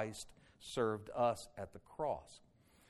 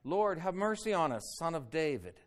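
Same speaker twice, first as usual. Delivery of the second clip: raised, louder plea; crying out; 5 semitones higher, 5 dB louder than usual. A narrator's reading. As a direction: call it out high, then ease down. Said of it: "they're crying out".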